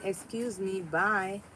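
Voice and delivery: usual speaking voice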